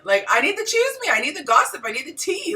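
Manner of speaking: high, loud, insistent demand for gossip; eager pleading. Retold or imitated. Wife wanting gossip from her husband, playfully insistent. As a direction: re-enact begging for juicy details, pitch way up.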